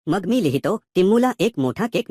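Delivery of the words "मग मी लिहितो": ordinary delivery, nothing marked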